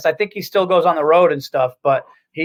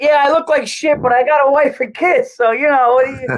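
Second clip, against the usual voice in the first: higher and louder mock married-guy whine; excuse-making guy; 7 semitones higher, 3 dB louder than usual. Mocking married guys excusing weight gain, higher and louder. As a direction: ham up a lazy husband's excuse. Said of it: "Guys always do that".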